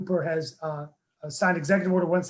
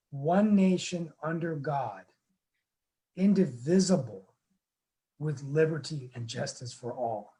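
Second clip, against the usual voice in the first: slow pledge with long pauses between phrases; slow, deliberate recitation; slower than usual. Shown as a demonstration. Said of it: "the words to myself slowly".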